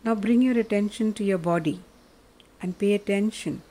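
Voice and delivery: ordinary delivery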